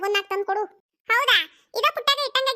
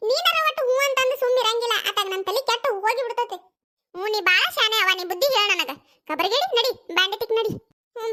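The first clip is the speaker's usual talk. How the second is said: ordinary delivery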